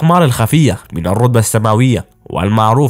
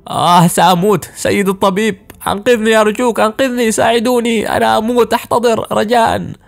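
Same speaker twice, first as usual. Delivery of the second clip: high-pitched cries of a patient in agony; agonized pleading; 10 semitones higher than usual. A narrator's reading. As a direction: beg the doctor desperately, voice pushed very high. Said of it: "يتألمون بشدة". (استغاثة متألمة بصوت مرتفع الطبقة)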